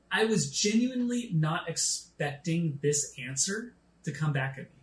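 ordinary talk

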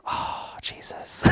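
whispered, stunned "Oh, Jesus"; awed whisper. Retold or imitated. Pastor convicted by God, whispering in shock. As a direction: breathe it out like you've been caught. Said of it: "I was like".

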